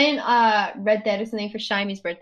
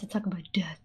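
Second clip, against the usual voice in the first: whisper; 3 semitones lower, 11 dB quieter than usual. Acted. Mock-ominous change of topic.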